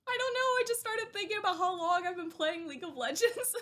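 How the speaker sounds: high, choked-up, laughing at herself; fighting back happy tears; emotional. Real, not acted. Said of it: "I'm getting emotional".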